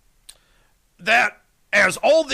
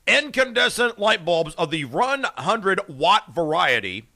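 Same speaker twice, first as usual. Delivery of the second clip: ordinary talk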